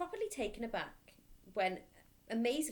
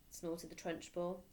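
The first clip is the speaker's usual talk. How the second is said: usual speaking voice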